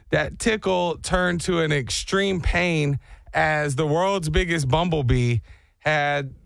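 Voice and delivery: ordinary delivery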